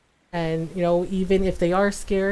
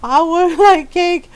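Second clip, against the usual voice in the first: excited; 10 semitones higher, 9 dB louder, slower than usual. Real, not acted. Excited about the cake.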